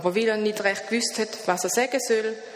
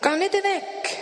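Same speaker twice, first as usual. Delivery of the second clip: calling out; 12 semitones higher, slower than usual. A narrator's reading. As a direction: call after the leaving friend. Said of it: "ruft er". (er ruft dem Freund nach: Wart!)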